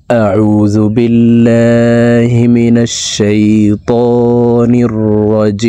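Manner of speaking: slow, measured tajweed chant; unhurried melodic Arabic recitation, held on one note; Quran recitation. Shown as a demonstration. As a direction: recite with long, sustained vowels.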